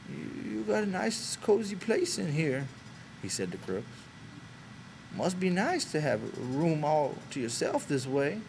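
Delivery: put-on a shy old ranch hand easing into small talk; Candy's old man voice. A narrator's reading.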